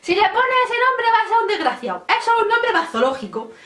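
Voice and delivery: high, indignant mock-grandma rant; granny outraged over a baby name, mock high voice; imitating grandma. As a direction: ham up a blunt grandmother scoffing at the name. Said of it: "me dijo".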